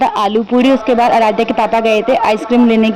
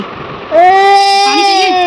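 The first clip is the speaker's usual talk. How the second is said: put-on "पानी चाहिए" drawn out very slowly, high and teary like a toddler; crying child mimic; 9 semitones higher, much slower than usual. Mother imitating her daughter tearfully asking for water. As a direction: drag "पानी" out in a weepy, high child's wail.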